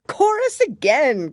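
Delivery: high, loud call; a creature crying out, high and loud; eager cry. A narrator's reading. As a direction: shout for the chorus. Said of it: "cried the Gryphon".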